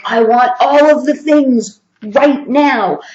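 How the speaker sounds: eager, loud and drawn out; blurting it all out at once; overexcited. Retold or imitated. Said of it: "you might get a little overexcited and be like".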